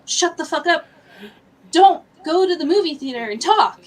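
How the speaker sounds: heated mock telling-off; retold angry outburst. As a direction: tell off noisy moviegoers, re-enacted. Acts out angry outburst at girls.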